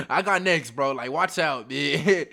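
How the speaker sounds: loud call. Retold or imitated. Insisting he has next game.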